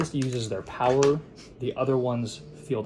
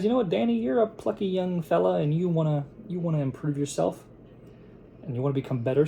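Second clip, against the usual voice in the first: higher-pitched retold mentor's pep talk; mock mentor; 6 semitones higher than usual. Mentor tells a plucky young fella he will teach him, higher mock pep talk. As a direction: play the encouraging mentor, pitched up.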